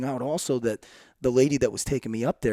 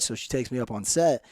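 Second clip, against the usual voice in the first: ordinary talk, nothing marked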